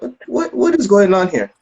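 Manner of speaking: high, stammered disbelief; baffled alarm. Retold or imitated. Retold bafflement at father's arrival.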